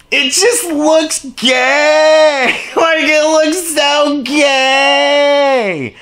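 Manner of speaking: high, stretched-out mocking whine; long sing-out of disdain; drawn-out mockery. Real, not acted. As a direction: drag the last word way out, high. Mocking the forum's anonymity.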